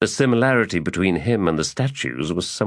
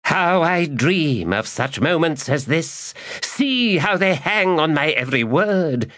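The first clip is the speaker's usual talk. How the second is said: gleeful gloat; 11 semitones higher than usual. Acted. Magus gloating over the two women, high and exultant. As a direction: savour the moment aloud.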